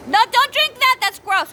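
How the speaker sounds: high, loud real scolding; urgent calling out. Real, not acted. Mother breaks off her story to stop her child drinking, urgent shout.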